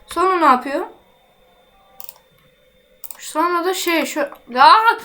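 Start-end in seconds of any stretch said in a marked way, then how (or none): 4.6-5.0 a sudden loud high "Aa!"; startled cry; surprised gasp. Real, not acted. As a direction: jolt with surprise. Sudden discovery in the game.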